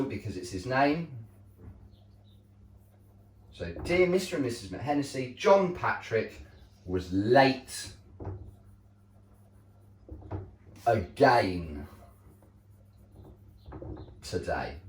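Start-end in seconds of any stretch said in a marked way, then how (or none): 5.4-14.8 slow, emphatic grumpy complaint, with pauses; a teacher showing a grumpy exclamation to the class. Shown as a demonstration. Teacher reading a model letter aloud, slow and grumpy. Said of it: "an exclamation sentence shows your strong emotion".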